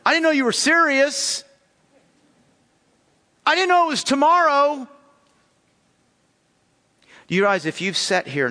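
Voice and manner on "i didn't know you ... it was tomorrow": much higher, louder mock whine of an unprepared student; whiny excuse-maker. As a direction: ham up the lame excuse. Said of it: "Here's my favorite one".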